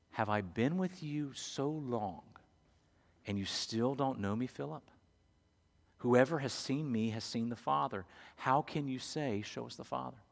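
a quiet, tender correction; gentle rebuke. Acted. Jesus gently answering Philip.

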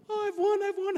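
high, gleeful "I've won" of a runner celebrating too early; mock victory cry. Runner in video celebrating before finish line, high mock gloating. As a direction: crow it out like you think you've won.

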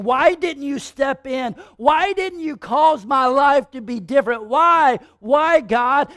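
loud, high, pleading cry to God; a hurt believer shouting why at God; angry prayer. Pretend. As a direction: demand answers from God, voice rising. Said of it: "Or maybe this".

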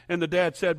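normal talking voice

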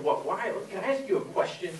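put-on quoting a puzzled professor's northern accent. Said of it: "She had that kind of northern".

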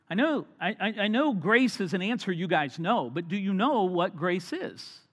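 usual speaking voice

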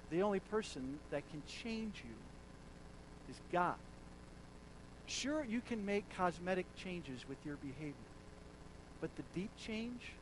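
normal talking voice

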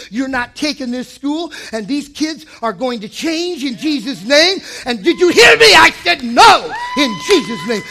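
building from firm to a full shout; defiant rebuke. Acted. Preacher rebuking the enemy, building to a shout. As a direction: rebuke the enemy, rising to a yell.

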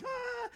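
high, thin mock "aah"; mock freak-out cry. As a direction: imitate a panicked little wail. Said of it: "trying to freak you out".

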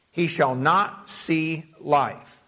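"He shall not see life" slowly, word by word; slow down and separate "not", "see", "life"; emphatic repetition. Real, not acted. Repeats the verse for emphasis.

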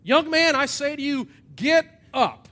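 loud, high, ringing command. Acted. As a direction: call out an order for all to hear. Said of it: "Jesus will actually say out loud".

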